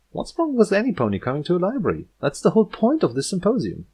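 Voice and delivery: brisk defensive Twilight. Acted. Twilight defending the guest to Yearling, quick and puzzled. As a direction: rattle it off innocently.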